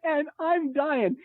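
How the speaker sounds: high, slow, laughing delivery; laughing while telling. Real, not acted. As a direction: crack up on the punchline. Cracking up at the story's punchline.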